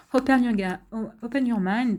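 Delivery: amused, French-accented English; playful go at English in a thick French accent; joking English accent. Real, not acted. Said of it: "accent anglais".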